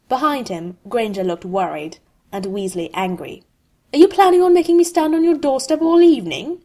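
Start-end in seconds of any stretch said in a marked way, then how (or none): 3.9-6.6 high, loud, rapid indignant demand; fast, raised-pitch irritation; snapped. A narrator's reading. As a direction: snap it out impatiently in one rush. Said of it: "Malfoy snapped".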